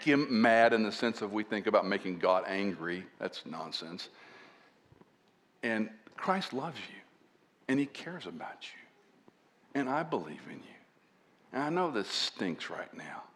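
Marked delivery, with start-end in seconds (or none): 5.6-13.2 soft, slow consoling words; reassuring. Shown as a demonstration. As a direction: comfort someone who is hurting. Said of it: "when they speak friendly and reassuring to you".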